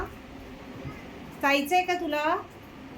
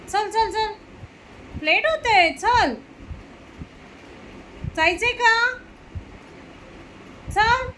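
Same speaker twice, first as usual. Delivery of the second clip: high, sing-song coaxing; coaxing pet voice; 7 semitones higher, slower than usual. Real, not acted. Mother coaxing her dog to come for a walk, high coaxing. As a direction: lure the dog out with a bright high call.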